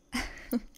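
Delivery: short, breathy little laugh; teasing chuckle. Acted. Teasing laugh after scary talk.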